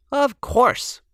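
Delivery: lightly voiced confident girl; Lily answering. A narrator's reading. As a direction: answer like it's obvious, way up. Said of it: "said Lily".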